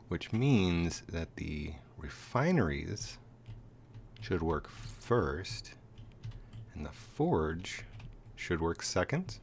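slow, half-whispered reasoning; slow thinking aloud. Real, not acted. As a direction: work it out slowly under breath. Reasoning out the order.

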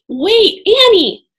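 loud, high call to stop her; urgent call after his sister. Acted. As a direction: shout a warning up the steps. Jack calls to stop Annie.

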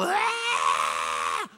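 mock wail. Mourners wailing at funeral.

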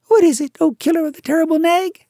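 very high, loud chirpy bird voice; a shrill little bird, bursting with triumph; tailorbird. A narrator's reading. Bird tells the mongoose the news, triumphant. Darzee speaking.

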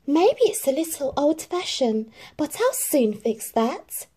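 plan it out brightly; hopeful Cinderella. A narrator's reading. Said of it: "said Cinderella".

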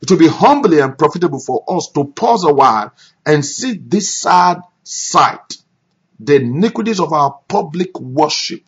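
fervent preacher re-reading the passage; preached re-reading. Shown as a demonstration. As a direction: thunder the words at the congregation. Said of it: "it's talking about".

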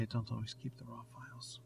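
hushed little confession; whispering. Real, not acted. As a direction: whisper it, slightly guilty. Confessing about raw files.

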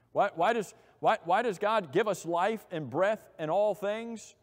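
normal talking voice

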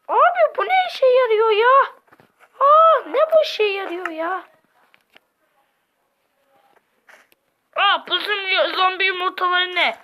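high, loud alarmed cry; shocked outburst. Pretend. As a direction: yelp it out in disbelief. Character shocked at zombie eggs.

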